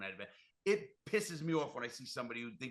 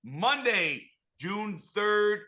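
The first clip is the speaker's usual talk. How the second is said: higher, louder announcer read; host plug read; 9 semitones higher, 12 dB louder than usual. Acted. Reminding listeners about his guest's new show, announcer voice.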